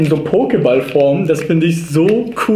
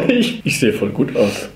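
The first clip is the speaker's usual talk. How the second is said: ordinary talk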